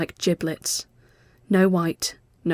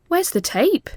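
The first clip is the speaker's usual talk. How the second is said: sharp question; 11 semitones higher, 4 dB louder than usual. A narrator's reading. Mel suspicious.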